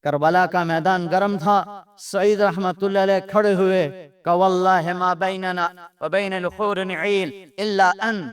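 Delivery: ordinary talk, nothing marked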